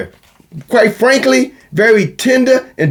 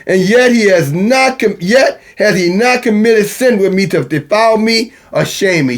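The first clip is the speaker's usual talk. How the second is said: raised, ringing scripture recital; scripture reading; 5 semitones higher than usual. Acted. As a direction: read it out like holy writ.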